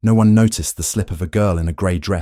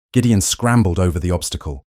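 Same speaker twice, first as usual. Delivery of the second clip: ordinary delivery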